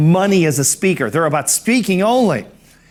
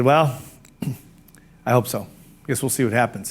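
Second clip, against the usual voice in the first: low, quiet, glum reply; not cheery; 5 semitones lower, 4 dB quieter than usual. Retold or imitated. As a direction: answer half-heartedly, dropping pitch and volume. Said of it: "I was not cheery".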